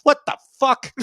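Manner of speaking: high, loud cry of mock disbelief; outraged sports fan. Reacting to yesterday's news like a Bills fan, mock outrage. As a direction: yell it like a fan at a bad call. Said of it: "I was like a Bills fan".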